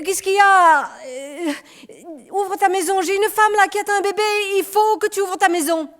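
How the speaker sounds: high, loud begging; desperate man begging for shelter for a woman; urgent plea. Acted. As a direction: plead to be let in. Begging neighbour to open house.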